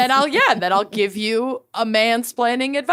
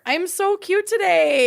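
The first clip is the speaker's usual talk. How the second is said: playful; 10 semitones higher than usual. Pretend. Joking about her sweater.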